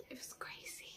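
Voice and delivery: whispered aside. Real, not acted. Aside about past self.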